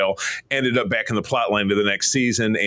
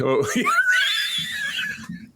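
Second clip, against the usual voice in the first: very high-pitched, drawn-out laugh; real laughing fit. Real, not acted. Cracking up at co-host's joke, high real laughing fit.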